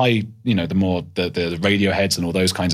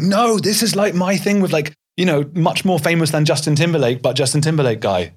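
excited protest; 7 semitones higher than usual. Retold or imitated. Host imagining defending his guest to the audience, excitedly. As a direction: insist he is a huge star. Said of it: "And I wanted to be like".

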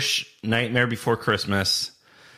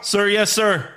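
recruit voice; 7 semitones higher than usual. Acted. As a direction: answer like a scared recruit. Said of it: "Do you wanna be Private Cowboy".

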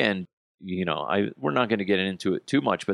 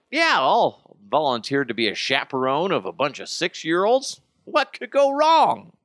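mock-cheerful, rising high on the last words; blithely volunteer, drawing out the final question; sarcastic rosy optimism, higher and stretched at the end; breezy naive eagerness; 5 semitones higher than usual. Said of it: "I was like".

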